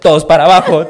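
orden gritada, alta y fuerte; escolta dando órdenes. Retold or imitated. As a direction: ladra la orden como escolta borracho. (escolta borracho gritando órdenes con la pistola)

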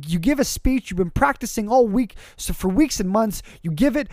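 ordinary talk

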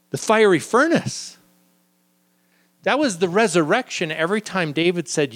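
normal talking voice

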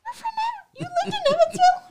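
high-pitched imitation of stunned surprise; mock astonishment. Co-host imitating her forgetful friend's reaction, mock astonishment. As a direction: squeal it as if hearing it for the first time.

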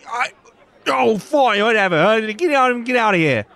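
loud, high shooing-off grumble; blustering barman caving; deflated bartender. Acted. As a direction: give in but save face, shoo them away. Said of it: "he's just like".

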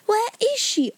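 high, urgent question; near shriek. A narrator's reading. Jessie demands news of Sarah, almost shrieking. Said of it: "almost shrieked Jessie".

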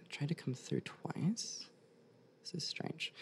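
very slow puzzled whisper. Real, not acted. Medium puzzled during reading.

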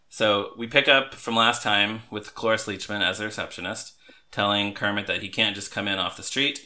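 ordinary talk

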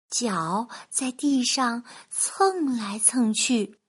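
plain reading voice

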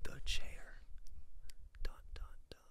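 whispered dramatic title; spooky whisper. Pretend. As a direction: dun dun dun, spooky hush.

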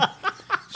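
high breathy laugh. Real, not acted. Laughing at lotion joke.

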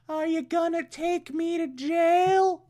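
high, slow femme fatale falsetto; Octavia. Acted. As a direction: coo it slowly in a put-on high female voice. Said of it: "she's like".

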